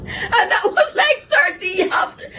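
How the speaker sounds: breaking into laughter mid-sentence; real laughter while telling how long it took; laughing. Real, not acted. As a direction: laugh through the words, nearly crying. Laughing recalling the story.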